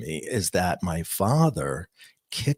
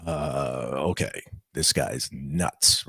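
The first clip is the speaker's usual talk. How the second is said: lower, slow, softer skeptical mutter; a paramedic muttering to his partner, lower and softer; dubious paramedic aside; 7 semitones lower, 5 dB quieter, slower than usual. Retold or imitated. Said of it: "And they're looking at each other like".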